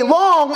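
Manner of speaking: long drawn out slowly and leaned on; stress on long. Shown as a demonstration. Preacher to mourners, hitting long with slow stress. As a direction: stretch the word long to make the point. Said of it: "and I stress the word long".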